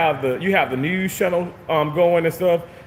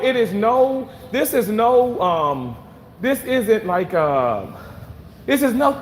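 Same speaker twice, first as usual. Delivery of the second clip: pointed dislike; 7 semitones higher than usual. Real, not acted. Addressing absent coworker.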